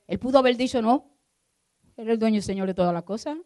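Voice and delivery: predicación fuerte y alta, exhortando; predicación con fuerza. Acted. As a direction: clama desde el púlpito. Preaching at full force. (el pastor exhorta a la iglesia con fuerza)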